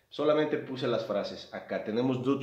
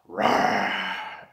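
a loud growling roar, voice pushed out of shape; lion roar; 4 semitones lower, 6 dB louder than usual. Shown as a demonstration. Teacher showing the class what a lion's roar sounds like, loud roar. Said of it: "ese sonido que hacen".

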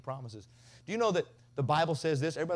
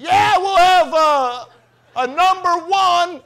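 mock shout, much higher and slow; yelling at the drive-thru; 17 dB louder, much slower than usual. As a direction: bellow the order at the drive-thru speaker. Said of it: "this is how he does it".

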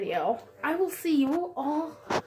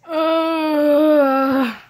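disgusted ugh; 3 semitones higher, 8 dB louder than usual. Real, not acted. Reacting to being covered in mess.

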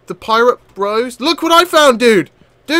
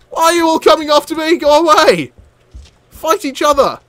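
high, loud protest; exasperated yelling; 9 semitones higher, 4 dB louder than usual. Real, not acted. Player to pirates coming after him, exasperated yelling. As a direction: shout in exasperation at being ganged up on.

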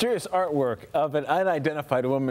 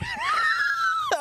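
burst of laughter. Real, not acted. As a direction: break into helpless laughter. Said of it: "I can't do it".

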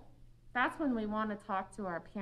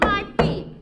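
loud, high angry outburst; acted tantrum for the kids; angry stomp; 11 semitones higher, 13 dB louder than usual. Shown as a demonstration. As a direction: show an angry kid stamping his feet. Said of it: "What if I'm angry and I decide".

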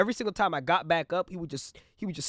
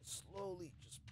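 very soft, hushed smooth move; hushed demonstration of the smooth move; sly demo; 19 dB quieter than usual. Shown as a demonstration. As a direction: barely breathe it as you act out the stretch. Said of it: "pretend this chair is her".

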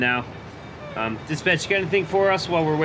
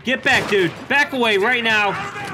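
shouting commands; 7 semitones higher, 4 dB louder than usual. Pretend. Pushing back attackers.

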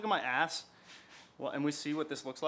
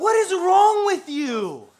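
loud, high, drawn-out scolding; outraged shout; 13 semitones higher, 13 dB louder, much slower than usual. Real, not acted. Friend scolding a drunk buyer, outraged shout. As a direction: stretch it into a real high yell.

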